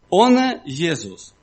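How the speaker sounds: ordinary delivery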